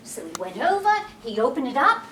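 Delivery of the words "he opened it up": usual speaking voice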